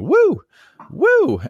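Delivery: high, loud imitation of a heckler's whoop; retelling a heckler whooping at a comedian, high and loud; mock heckler whoop. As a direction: mimic a rowdy audience member whooping. Heckler shouting during comedy show.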